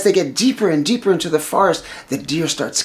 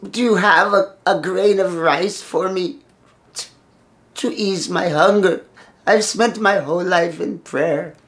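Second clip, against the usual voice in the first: higher-pitched plaintive beggar; pitiful old man; 4 semitones higher than usual. Acted. Old man asks for rice to ease hunger, plaintive. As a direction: beg haltingly like a hungry elder. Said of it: "pitiful old man with long matted hair".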